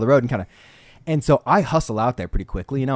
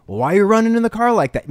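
higher, louder mock indignant driver; imitating the Uber driver; 10 semitones higher, 6 dB louder than usual. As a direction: play the driver scolding loudly. Uber driver voiced in story.

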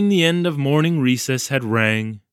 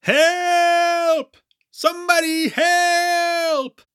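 high, drawn-out desperate shout; yelling for help; much slower than usual. A narrator's reading. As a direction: cry out for rescue, pitched way up. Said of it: "yelling at the top of my lungs".

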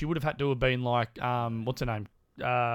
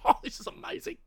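breathless whispered wonder, pitched up high; mock awed gasp; 13 semitones higher than usual. Imitating a viewer watching Charlize Theron, awed whisper. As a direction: gush like a starstruck fan.

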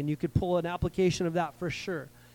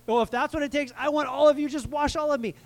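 eager Peter; 6 semitones higher, 3 dB louder than usual. Retold or imitated.

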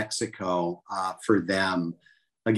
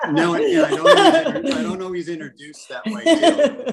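laughing; 11 semitones higher, 6 dB louder than usual. Real, not acted.

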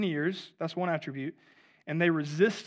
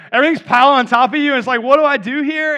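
loud, high, frantic exclamation; overwhelmed outburst; 6 semitones higher, 15 dB louder than usual. Acted. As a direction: raise the voice as if swamped at work. Acting out an overwhelmed worker.

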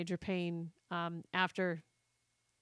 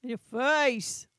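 mock taunt; 4 semitones higher, 7 dB louder, much slower than usual. Mock taunt echoed back.

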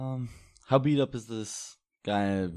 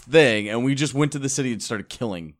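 loud, high-pitched comic disbelief; incredulous outburst; 7 semitones higher, 10 dB louder than usual. Real, not acted. Player questioning the party's killing spree, incredulous. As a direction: blurt it out, louder and higher.

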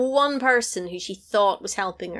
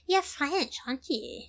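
mocking scrunched face; 7 semitones higher, 6 dB quieter than usual. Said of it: "scrunch your face up and go".

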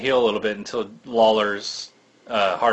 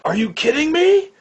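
put-on much higher, squealing excited kid; thrilled disbelief; 3 dB louder than usual. Young fan reacting to Hogan winning, thrilled and squealing. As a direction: recreate your younger self's giddy shock. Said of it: "I'm like".

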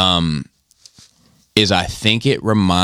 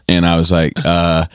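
ordinary delivery

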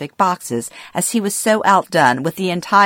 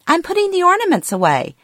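mother's voice pitched much higher, a bit fast; confused elderly mother; 14 semitones higher, faster than usual. Retold or imitated. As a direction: play the muddled mom, sure she's helping. Mom answers during ornament argument.

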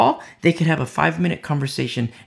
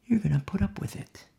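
hushed, ominous whisper; whispered warning; 5 semitones higher, 6 dB quieter than usual. Acted. End of Paul's quoted warning.

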